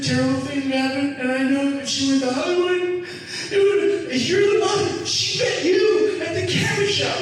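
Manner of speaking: very high, hammed-up tearful wail; high-pitched mock sobbing voice; imitated sobbing. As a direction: play an overcome mother wailing her thanks. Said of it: "she starts sobbing, going".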